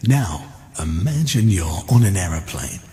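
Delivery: low, slow hypnotic voice; mock hypnotist calm, drawn out; Paul McKenna hypnotist. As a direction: lull them into a trance, slow and low. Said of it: "he goes".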